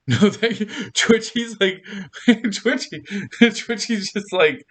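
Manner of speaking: high, giggly talk broken by laughs; real laughter through the words; laughing. Real, not acted. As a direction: try to tell it while cracking up. Cracking up at Twitchy's reaction.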